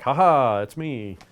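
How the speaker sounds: loud, high taunting laugh then reveal; gloating villain reveal. Retold or imitated. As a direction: gloat as you unmask. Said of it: "rip the mask off and like".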